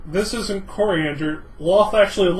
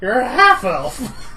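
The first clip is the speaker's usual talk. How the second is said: excited shout; 4 semitones higher, slower than usual. Real, not acted. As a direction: blurt it out in delight. Excited table reveal shout.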